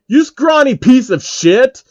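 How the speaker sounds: loud, high, slow furious scream; enraged lunge. Acted. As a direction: scream the insult. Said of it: "Phil screams".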